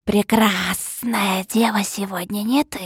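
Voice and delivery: slow hissing whisper; serpent whisper. A narrator's reading. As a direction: hiss it slowly like a snake. Змей шепчет героине. (змеиный шёпот)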